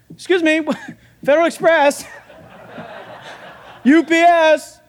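hammed-up courier announcing himself through laughter; mock delivery man. [name] as courier calling out.

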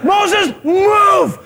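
high, furious mock shout; hammed-up wrathful yell; God. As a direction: holler as if about to wipe them all out. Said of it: "And God says".

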